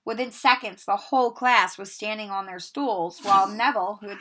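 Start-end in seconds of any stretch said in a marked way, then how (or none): none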